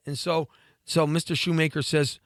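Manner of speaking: normal talking voice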